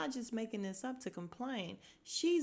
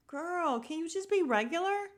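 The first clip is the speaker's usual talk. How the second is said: higher, exasperated plea; mock-exasperated, pitched up; 9 semitones higher than usual. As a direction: plead with her to act normal. Her exasperated reply to the actress.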